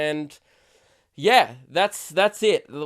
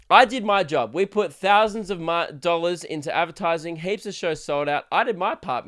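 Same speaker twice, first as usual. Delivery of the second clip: ordinary delivery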